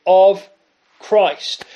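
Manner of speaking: loud OF set apart, then Christ; key word OF, punched and separated; stress on OF. Shown as a demonstration. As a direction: hit "of" hard, pause before "Christ.". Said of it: "key words here is".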